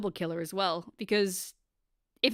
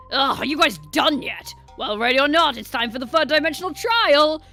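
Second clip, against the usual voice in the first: loud announcer, rising higher; impatient game host; 4 semitones higher, 9 dB louder than usual. Acted. Host summoning everyone to the trial, loud impatient announcement.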